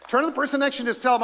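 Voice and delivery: fast, high, loud call to the room; excited urging. Real, not acted. Pastor urging the congregation to greet neighbours, fast and loud. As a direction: rush them to turn and greet.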